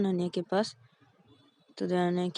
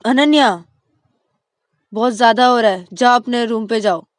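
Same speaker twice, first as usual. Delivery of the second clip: louder, sharp call then order; scolding mom; 3 semitones higher, 12 dB louder than usual. Acted. Mother scolding Ananya to go to her room, loud and stern. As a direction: send the child to her room, firmly.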